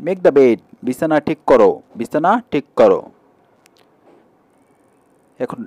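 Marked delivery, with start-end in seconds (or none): none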